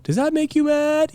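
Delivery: high-pitched rabble-rouser; crowd-baiting preacher. Acted. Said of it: "bringing up issues that will make them mad".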